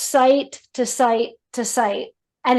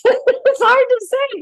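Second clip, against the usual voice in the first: laughing; 13 semitones higher, 5 dB louder, slower than usual. Real, not acted.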